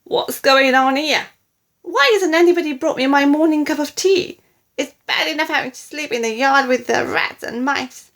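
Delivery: loud, high, indignant grandma; shouting. A narrator's reading. Grandma demanding her morning tea, indignant shouting. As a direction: rattle off a loud, outraged complaint. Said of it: "she shouted".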